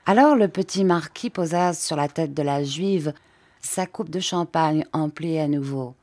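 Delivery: ordinary delivery, nothing marked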